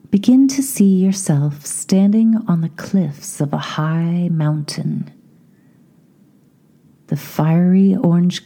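slow, spacious meditation voice with long pauses; unhurried, projected visualisation lead; guided meditation. Acted. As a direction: guide the listener inward, phrase by phrase.

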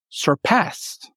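"surpassed" said alone, weight on PASSED; second-syllable stress. Shown as a demonstration. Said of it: "the accent falls on the second".

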